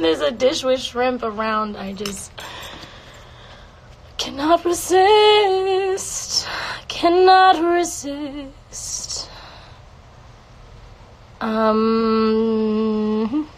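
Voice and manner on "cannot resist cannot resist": high, very slow, stretched out; dramatic drawl. Real, not acted. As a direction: moan it out theatrically, slowly. Dramatic confession about shrimp.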